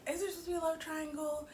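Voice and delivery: a high, quick, baffled question; high fast incredulous question. Retold or imitated. Recapping the show, asking about the love triangle in a high quick voice. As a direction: pitch it up high and rush it. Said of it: "I was like".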